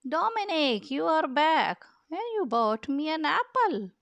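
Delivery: high, bright happy greeting; delighted greeting. Acted. A new owner greets the returning moose, high and happy. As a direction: welcome him home with joy.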